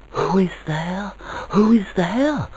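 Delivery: put-on high-pitched child, half awed, half afraid; child crying out in wonder. A narrator's reading. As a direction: call out like a wondering, timid child. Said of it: "cried the child of dreams, half in wonder, half in fear".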